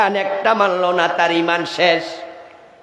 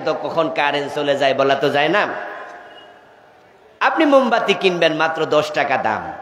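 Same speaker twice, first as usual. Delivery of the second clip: normal talking voice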